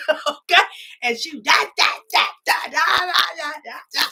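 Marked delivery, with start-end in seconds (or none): 1.4-4.0 high-pitched mock ranting in nonsense syllables; imitated top-of-lungs yelling. Imitating an employee yelling over a caller, mock high-pitched rant. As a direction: mimic someone yelling nonstop, pitched well up. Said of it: "at the top of your lungs".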